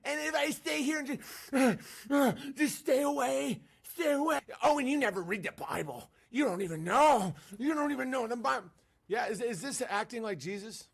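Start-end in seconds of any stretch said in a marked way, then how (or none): 0.1-8.7 outraged Christians. Retold or imitated. Christians scolding him over mosque greeting, high indignant voice. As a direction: scold like a pious busybody. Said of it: "the Christians are like".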